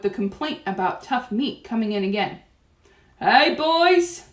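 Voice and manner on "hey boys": high, loud hail; shouting. A narrator's reading. Waiter hails the cooks, shouting. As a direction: call out to the lads. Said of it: "he shouted".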